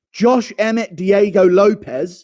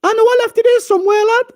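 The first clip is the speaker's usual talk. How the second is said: very high, quick Scouse-lad voice; Darren Till impression; 4 dB louder, faster than usual. Fighter hunting for his lost ligament, high-pitched impression. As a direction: search frantically in a high put-on voice. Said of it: "Impression of Darren Till looking".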